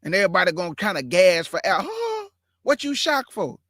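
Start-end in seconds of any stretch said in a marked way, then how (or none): 1.6-3.6 high startled huh; mock the shocked reaction; surprised. Mocking surprised parents.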